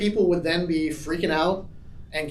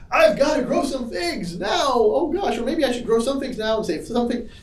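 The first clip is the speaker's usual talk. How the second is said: high-pitched panicking fig tree; mock freaking out; 7 semitones higher than usual. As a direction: play a fig tree fretting in a comic panic. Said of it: "The fig tree wasn't freaking out".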